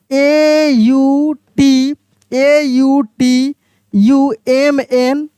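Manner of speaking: letters A U T U M N dragged out very slowly; slow spelling out. Shown as a demonstration. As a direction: spell out each letter for the class. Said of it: "इसको हम लोग ऑटम पढ़ते हैं".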